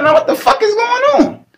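high, fast, baffled outcry; squeaky mock disbelief at a boring dream; mock bewildered. Quotes self in dull dream.

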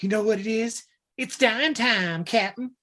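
hammed-up little villain; menacing kid. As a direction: stretch it out in a high kid voice. Retold kid threatens captain.

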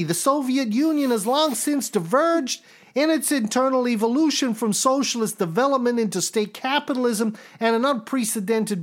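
the impression sliding into a nervous neurotic; Woody Allen-ish Pesci. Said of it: "that sounds more Woody Allen".

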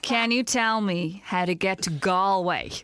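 low, slow mock-seductive voice; mock dirty talk. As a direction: coax the phone as if talking dirty to it. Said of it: "used to have to talk almost dirty to Siri".

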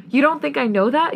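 growling. A narrator's reading. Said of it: "he growls".

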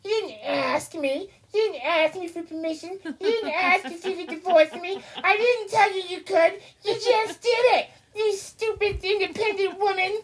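high, whiny mockery of an ex-husband scolding his wife; mocking Cody. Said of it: "he actually had the balls to say that".

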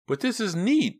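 very high, loud, thrilled protest; excited exclamation. A narrator's reading. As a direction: insist on the fun, voice soaring. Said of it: "Mickey exclaimed".

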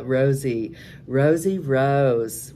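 stretched, loud greeting; calling out a name. Real, not acted. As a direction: sing out to greet her.

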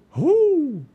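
long, very loud, high hoot; owl hoot. A narrator's reading. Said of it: "said the snowy owl".

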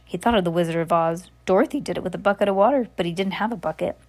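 ordinary delivery, nothing marked

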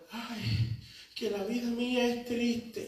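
soft, hammed-up self-pity; mock lament. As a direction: moan it like a drama queen. Mocking a self-pitying complainer.

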